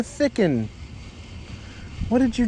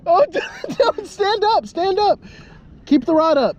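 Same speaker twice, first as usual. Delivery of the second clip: high excited shouted instructions; urgent coaching over the struggling fish; fighting a fish; 13 semitones higher than usual. Real, not acted.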